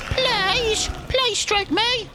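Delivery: high, slow pleading whine like a pet; whiny begging plea. Pretend. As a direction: beg to be stroked, drawn out. Begging to be stroked.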